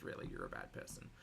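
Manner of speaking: breathy whisper, barely voiced; whispered aside. Real, not acted. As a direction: drop to a whisper for the jab. Muttered aside after the insult.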